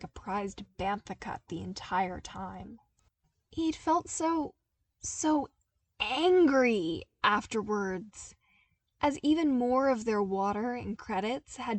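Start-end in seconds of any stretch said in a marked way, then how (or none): 3.5-8.3 slow, heavy stress on angry, loud; stressed anger. A narrator's reading. Narrator dwelling on Luke's anger, slow and heavy. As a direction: lean hard into "so, so angry".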